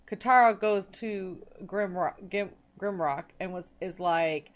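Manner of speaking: ordinary delivery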